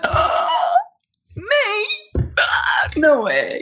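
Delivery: a gasped, squeaky "me?" then a breathy no way; acting out her own shocked refusal, voice jumping up; startled disbelief. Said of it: "And I went".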